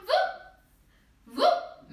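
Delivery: high squeaky whoop sounds; playful whoops. Acted. Cutting pasta with playful sounds.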